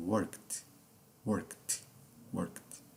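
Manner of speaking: "worked" in one syllable, the E skipped; silent E in worked. Shown as a demonstration. Teacher repeating worked for students to copy, E not pronounced. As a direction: model "worked" with the -ed as a bare final t. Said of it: "Então fica assim".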